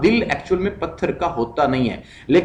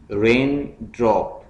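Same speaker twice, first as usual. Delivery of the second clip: रेन drop as two separate beats, pausing before drop; split into syllables; 2 semitones lower, slower than usual. Shown as a demonstration. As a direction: say रेन, pause, then drop. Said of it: "syllables में divide करना है".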